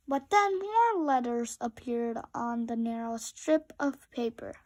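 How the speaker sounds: ordinary delivery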